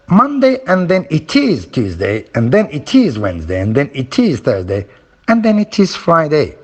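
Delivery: days on the beat, "and then it is" packed between them; rhythm drill. Shown as a demonstration. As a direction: swing the pitch through "and then it is", falling on Friday. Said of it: "activity you can use to practice stress".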